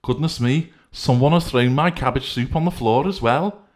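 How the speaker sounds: high, loud exclaiming character voice; Decency fussing over the soup, high and loud; Decency's voice. Acted. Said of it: "remarked Decency".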